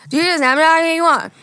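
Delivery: high, loud, slurred imitation; mumbled pickup line. Retelling the Walmart stranger, slurred and high. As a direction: mimic the guy's garbled come-on.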